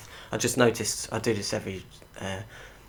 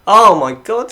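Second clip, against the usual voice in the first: excited shout; 11 semitones higher, 17 dB louder, slower than usual. Real, not acted. Goal chance excitement.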